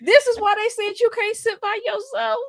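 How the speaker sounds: very high exasperated telling-off; exasperated scolding. Retold or imitated. Scolding an elderly friend planning escape, exasperated.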